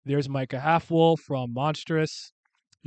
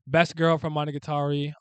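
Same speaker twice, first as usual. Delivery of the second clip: ordinary talk, nothing marked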